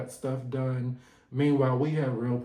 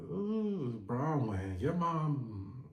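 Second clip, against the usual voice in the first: ordinary delivery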